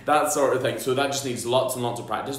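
ordinary talk, nothing marked

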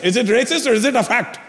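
imitated argumentative father with Indian accent; dad's Indian accent. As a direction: challenge like a stubborn Indian dad. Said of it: "He goes".

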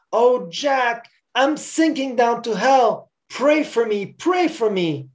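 high, slow anguished plea; agonized crying out. A narrator's reading. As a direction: beg desperately, drawing out the words. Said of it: "in agony, crying".

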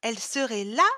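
high, loud cry of disbelief; startled shouted question, rising sharply; shocked yell. A narrator's reading. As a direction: yell it as if recoiling. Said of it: "hurla Chloé en reculant brusquement".

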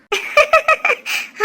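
high, light giggle; amused laugh. Acted. Baby sleeps through his bath, high amused laugh. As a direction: giggle at the thought. Baby dozes while being bathed.

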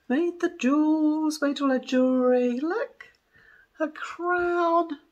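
high, slow proud showing-off; proud reveal. Real, not acted. Showing viewers the doll's crown, high and thrilled. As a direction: lift the voice up high to show off the crown.

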